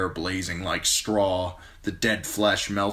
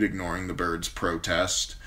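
plain reading voice

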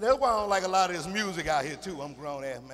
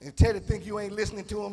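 ordinary talk, nothing marked

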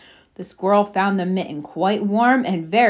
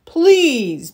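loud, higher-pitched plea; begging bear; 5 semitones higher, 6 dB louder than usual. Acted. Bear begs the animals for room, loudly pleading. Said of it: "begged the bear".

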